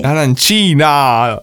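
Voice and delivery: "Arancina" shouted slow, high and loud; insistent shout. Real, not acted. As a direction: draw out "Arancina" loudly and high. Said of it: "insisting on correct word".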